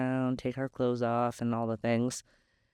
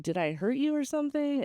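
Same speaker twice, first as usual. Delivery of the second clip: high, anxious asking; worried question; 11 semitones higher than usual. Retold or imitated. Asking the crying man if she hurt him, high and worried.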